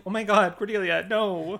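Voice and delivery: high pained cry of sympathy; anguished dismay. Retold or imitated. Watching Cordelia open smuggled sandwiches, high pained dismay. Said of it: "I was just like".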